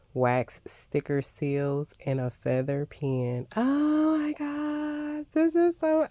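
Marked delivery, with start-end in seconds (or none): 3.6-6.1 excited squeal. Real, not acted. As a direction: squeal with real delight. Finding the wax seals.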